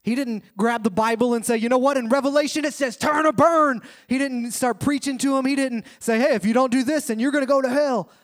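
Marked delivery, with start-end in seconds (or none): 1.6-3.8 loud, raised mock preaching; mock hellfire preacher. Preacher mocking a hellfire evangelist, loud and raised. 6.2-8.1 a hint of a scolding hellfire preacher; lightly voiced warning of hell; mock threat. Preacher mimicking a pushy evangelist, mock warning.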